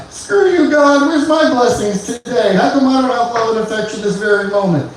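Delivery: high, strained mock yell at the sky; pleading mock rant, pitched high; mock yell at God. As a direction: whine at God for blessings. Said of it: "You guys ever yell at God?".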